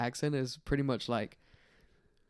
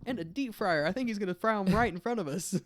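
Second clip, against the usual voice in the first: higher mock excitement; playful high voice; 8 semitones higher, 3 dB louder than usual. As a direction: keep the bit going brightly. Continued bit.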